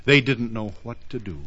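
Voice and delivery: ordinary delivery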